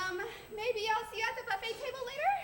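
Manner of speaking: rushed and hopeful. Acted. Hopeful invite as he leaves.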